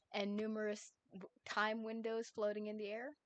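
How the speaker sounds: ordinary delivery, nothing marked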